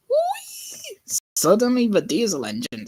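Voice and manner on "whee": very high, gliding squeal of joy; gleeful whee. Acted. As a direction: squeal it like a toy train. Engine racing joyfully.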